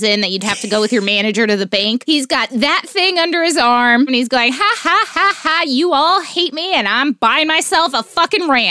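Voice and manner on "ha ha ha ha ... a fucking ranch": gloating laugh. Retold or imitated. Mock gloating Blippi.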